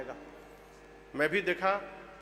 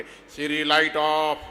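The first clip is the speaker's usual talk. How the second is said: lower, thicker mock voice for Siri; changed voice; 5 semitones lower, slower than usual. Frustrated preacher tries a lower voice on Siri. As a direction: put on a deeper voice to make it listen. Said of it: "आवाज बदल बदल के".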